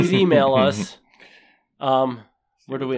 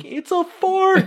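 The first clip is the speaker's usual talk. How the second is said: put-on high, slow panicky kid; 3 dB louder, slower than usual. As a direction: squeal it like a scared kid. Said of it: "'cause the one kid's like".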